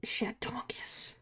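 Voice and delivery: slow whispered name. Real, not acted. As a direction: breathe the word secretly.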